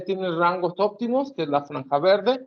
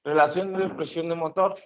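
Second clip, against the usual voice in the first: ordinary talk, nothing marked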